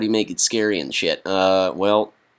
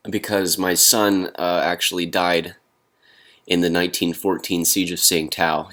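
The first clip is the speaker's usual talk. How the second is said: usual speaking voice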